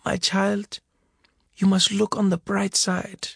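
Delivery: lightly voiced mother offering comfort; gentle mother. A narrator's reading. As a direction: counsel him tenderly. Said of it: "mother comforts son".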